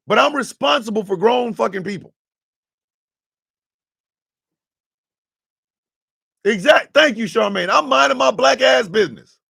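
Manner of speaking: high, loud indignant shouting; furious shout. Real, not acted. Telling the crowd he is minding his own business, shouting. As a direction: shout it, fed up and defiant.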